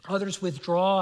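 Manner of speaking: ordinary delivery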